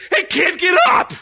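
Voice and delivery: high, loud barked order; impatient shout; harsh father. Retold or imitated. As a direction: snap at the fallen kid. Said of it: "he's not like".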